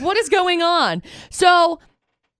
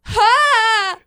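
high, loud scornful ha; mock scoffing laugh; 9 semitones higher, 4 dB louder than usual. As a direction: throw out a derisive ha. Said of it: "my whole soul is like".

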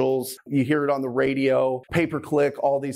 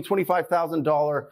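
ordinary delivery

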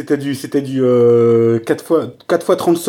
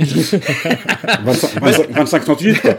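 laughing; much slower than usual. Real, not acted. Joking about a big dose.

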